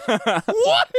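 incredulous yelp; real shocked disbelief. Real, not acted. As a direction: react as if the idea is outrageous. Shocked at shower advice.

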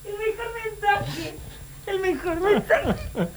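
high, soft squeak after laughing; squeaky, hushed delight; helpless amusement. Real, not acted. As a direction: say it weakly, overcome by laughter. Overcome with amusement at the message.

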